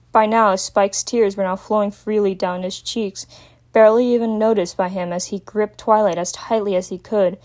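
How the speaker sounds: usual speaking voice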